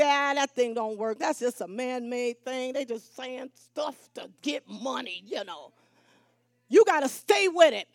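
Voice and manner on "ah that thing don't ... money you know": low, quiet grumble, trailing away; soft, offhand skepticism; dismissive doubter. Acted. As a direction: brush it off like a doubter at home. Voices doubter at home after church.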